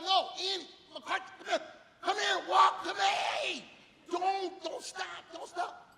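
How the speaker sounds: high, loud, drawn-out yelling to a runner; frantic coach shouting. Retold or imitated. Coach calls to his runner who stopped, frantic shouting. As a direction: urge her on at full shout. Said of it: "And I'm her coach. I go".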